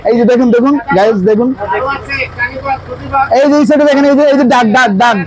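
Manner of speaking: high, eager pointing out birds; thrilled to spot the ducks; excited. Real, not acted. Spotting birds from the car.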